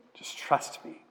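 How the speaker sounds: whispered tender plea. Acted. God whispering to thirsty Israel to trust him, whispered. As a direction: breathe it to them as a whisper.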